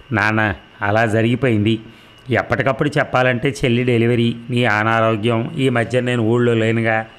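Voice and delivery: plain reading voice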